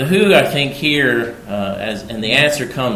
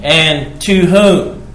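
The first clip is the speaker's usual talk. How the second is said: slow, loud scripture question; 5 semitones higher, 9 dB louder, much slower than usual. Acted.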